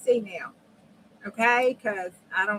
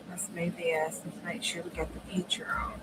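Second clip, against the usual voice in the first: whisper; 2 semitones lower, 9 dB quieter than usual. Real, not acted. Crafting, muttering to self.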